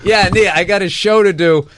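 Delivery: telling his crying wife he has a show, mock exasperated. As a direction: play it fed-up but amused. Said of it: "I was like".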